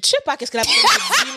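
crier en riant, très aigu; hilare, voix qui grimpe et qui monte en volume; fou rire. Real, not acted. Explique hilare le jargon incompris. (mort de rire)

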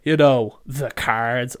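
loud, exasperated you know the cards; impatient mock explaining. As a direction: blast it like it's obvious. Repeats customer's line, louder.

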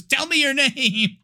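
hammed-up exorcist ordering an answer; mock exorcist demand. Nervous exorcist demands a demon's name, mock command.

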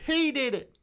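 high mock snitch voice; snitching bum. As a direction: squeal it out like a tattletale pointing.

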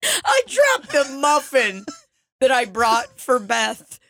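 high, slow, through real laughter; laughing helplessly. Real, not acted. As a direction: squeeze the words out while cracking up. Breaking up laughing while telling.

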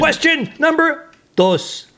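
high, slow, booming game-show announcer; hammed-up host calling the next question.

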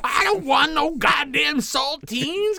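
high, loud squawky parrot rant. Pretend. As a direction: demand crackers like an angry bird. Costumed kid demanding crackers.

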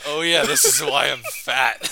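hammed-up sudden realization; mock realization. Real, not acted.

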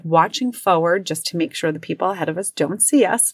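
ordinary talk

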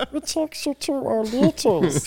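very high, slow squeaky alien voice. Acted. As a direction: drawl it like a cartoon Martian. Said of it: "You sound like, uh, Marvin Martian".

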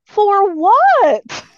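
loud, high exasperated retort; retold incredulous yell. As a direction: yell it back at the whisperer. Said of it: "You're over here yelling".

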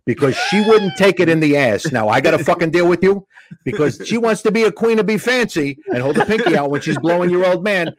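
rapid-fire comeback. Retold or imitated. Comic answering a huge heckler, said so quick. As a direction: fire off the insult without leaving a gap. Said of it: "But I said it so quick".

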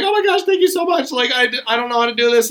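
high, rapid-fire grateful chatter; imitated gushing thanks. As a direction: gush quickly as the rescued woman. Imitating grateful, chattering stranded woman.

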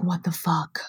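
whispered, stunned what the fuck; hushed horrified whisper; whispered shock. Retold or imitated. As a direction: breathe it out in disbelief. Mom reading the reviews.

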